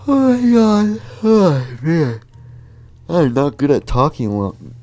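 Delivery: loud, high flustered outburst; flustered panic. Acted. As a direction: blurt it out in a panic. Flustered panic about dating.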